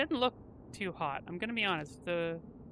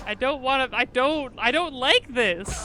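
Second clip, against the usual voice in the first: louder, strained refusal; anguished self-blame; 4 semitones higher, 5 dB louder than usual. Acted. Guilty character refusing mercy from the player, anguished and loud. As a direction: choke out the guilt.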